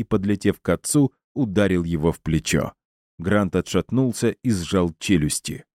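ordinary delivery

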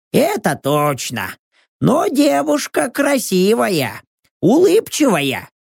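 high companion voice, drawn out; Rox. A narrator's reading. Rox admires the girl's photo, high character voice.

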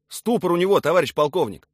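soldier's urgent call. A narrator's reading. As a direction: shout the report to your colonel. Officer calls out under fire.

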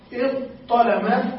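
إذ طلم said slowly with a crisp emphatic ط; slow, deliberate إذ طلم, ط clearly heard; model pronunciation. Shown as a demonstration. Teacher lists the second permitted form, slowly articulated. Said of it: "فنقول".

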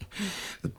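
short breathy scoff of a laugh. Acted. Shopkeeper scoffs at the potter's plan to visit the wholesaler, short breathy laugh. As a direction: laugh off his idea under your breath.

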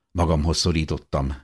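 usual speaking voice

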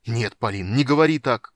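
loud cry with a cracking voice; breaking shout. Acted. Father cries out to his son, voice breaking. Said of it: "закричал Карамон срывающимся голосом".

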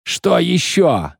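high, drawn-out yell; exasperated yell. A narrator's reading. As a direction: cry it out in exasperation. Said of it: "орал, размахивая руками". (отчаянный протяжный крик)

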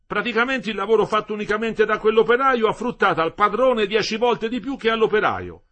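loud, high, emphatic worker's voice; Metello, fervent explainer. A narrator's reading. Husband explains labour theory to his wife, fervent and loud.

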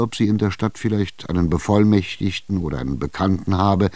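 ordinary delivery, nothing marked

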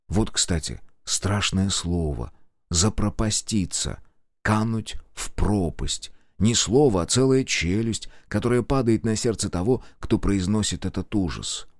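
ordinary delivery

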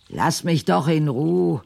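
high, loud outburst of frustration; fed-up housewife screaming for peace; exasperated shout. Acted. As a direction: yell it out, fed up. Snapping at the demanding husband.

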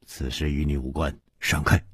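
low, soft stern warning; cold, hushed order to back off; masked stranger's low dismissal. A narrator's reading. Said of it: "蒙面客厉声说".

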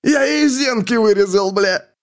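gloating. A narrator's reading. Gloating after manic giggle.

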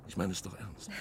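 fast low whisper. Acted. Suitor whispering back to Editha, quick and teasing. Whispered reply to hushed lover.

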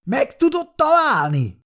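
high-pitched shouted repeat of a question; almost yelling. A narrator's reading. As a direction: demand it again in a near-yell. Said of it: "szinte ordítva ismételte meg a kérdést". (szinte ordítva, magas hangon)

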